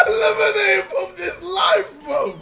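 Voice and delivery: loud, high, tearful dramatic yell; anime character voice. Retold or imitated. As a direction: shout it like an anime hero. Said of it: "talking like an anime character".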